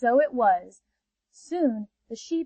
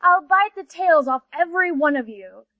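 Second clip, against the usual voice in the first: louder threatening she-panther; fierce threat; 4 semitones higher, 5 dB louder than usual. Acted. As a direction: snarl the threat. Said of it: "said the she-panther".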